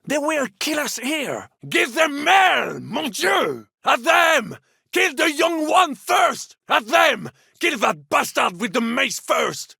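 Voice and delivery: high, loud commanding shout; rally the men and order the charge at full voice; battle cry. Acted. Captain shouting orders to his men in battle, loud and urgent.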